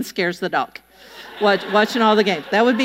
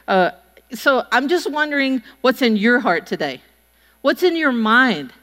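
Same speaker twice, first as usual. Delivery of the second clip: usual speaking voice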